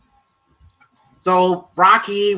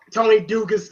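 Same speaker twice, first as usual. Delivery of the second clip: ordinary talk, nothing marked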